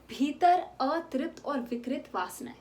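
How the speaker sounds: ordinary delivery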